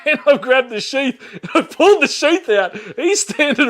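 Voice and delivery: can't stop laughing at his own blunder; cracking up while telling the knife story. Real, not acted.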